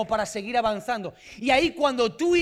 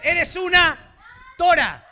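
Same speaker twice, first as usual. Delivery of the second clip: a loud, higher playful shout to the congregation; playful call-out; 6 semitones higher, 6 dB louder, slower than usual. Pretend. Preacher leading the men to call out to the women, loud and playful. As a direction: bellow it out like a joke cheer. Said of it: "díganle a las mujeres".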